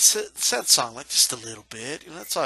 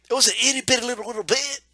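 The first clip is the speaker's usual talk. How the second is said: much higher, cutesy mock voice; high mocking baby voice; 9 semitones higher, 3 dB louder, much faster than usual. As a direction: squeak it out to ridicule the excuse. Mocking "a little bit" excuse.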